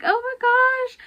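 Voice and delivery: high excited squeal, imitating herself; mock-excited high squeal at finally graduating. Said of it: "I'm like".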